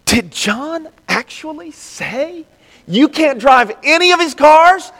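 high, slow, incredulous mock question; mock sly questioner. Preacher voicing the sly brother, high and slow. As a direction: needle them with a sneaky question.

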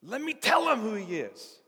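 high, loud, eager outburst; straining squeal of a hammed-up eager volunteer; bursting to tell. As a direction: beg excitedly for the chance to speak. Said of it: "And the rocks are just going".

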